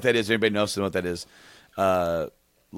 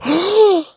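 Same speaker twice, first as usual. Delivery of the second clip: loud, awestruck gasp; big sucked-in breath of amazement; imitated gasp; 7 dB louder than usual. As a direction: gasp as if stunned by the match. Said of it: "And I would go".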